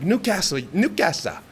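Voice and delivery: hammed-up Geordie impression of "Newcastle"; Geordie accent. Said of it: "one of the funniest ones".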